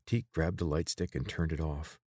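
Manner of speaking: usual speaking voice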